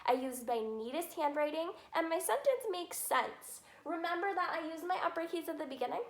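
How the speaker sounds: ordinary talk, nothing marked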